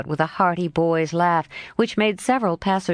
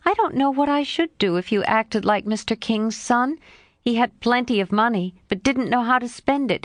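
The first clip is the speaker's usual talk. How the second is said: lightly voiced lively girl, a bit higher; a hint of a frank young girl, pitched up; Jo's voice; 5 semitones higher than usual. Jo answering her friend Laurie, lightly voiced girl.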